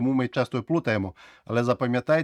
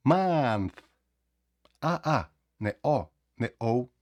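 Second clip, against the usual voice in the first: usual speaking voice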